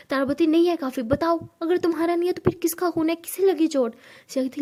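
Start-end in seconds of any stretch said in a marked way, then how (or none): 0.5-3.9 louder, higher, insistent questioning; angry demand. Acted. Tara confronting Siya about the blood on her hand.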